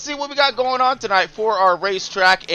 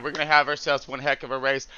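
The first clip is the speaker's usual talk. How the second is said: normal talking voice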